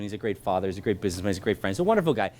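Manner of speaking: ordinary delivery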